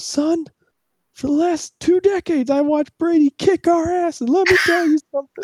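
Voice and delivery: high, strained ranting father; angry Jets dad. Retold or imitated. Jets fan dad warning his son about Brady, high strained rant. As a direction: crank the pitch up like a fed-up dad lecturing. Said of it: "And he goes".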